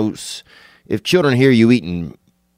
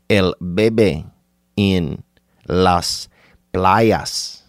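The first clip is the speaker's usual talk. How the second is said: put-on slow, separated words in Spanish accent; much slower than usual. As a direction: draw out each word with a Spanish accent. Switches into Spanish, mimicking nudger.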